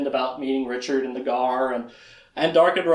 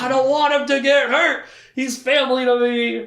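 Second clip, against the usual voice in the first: high, louder anxious plea; pleading Zed; 8 semitones higher, 4 dB louder than usual. Retold or imitated. Recapping the show, speaker voices Zed refusing his parents. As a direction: beg to protect family. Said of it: "He's like".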